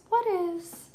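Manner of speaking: playful, very high squeaky echo; high-pitched repeat. Real, not acted. Streamer puzzling over a viewer comment, high-pitched echo.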